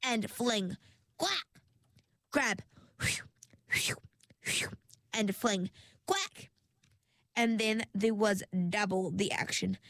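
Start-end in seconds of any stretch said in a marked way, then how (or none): 1.2-1.5 high little quack squeak; barnacle squeak. A narrator's reading. Barnacle squeaking as Ringlet flings it, high. 6.1-6.5 high little quack squeak; barnacle squeak. A narrator's reading. Barnacle squeaking as Ringlet flings it, high.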